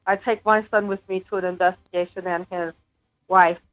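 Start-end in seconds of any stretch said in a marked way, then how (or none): none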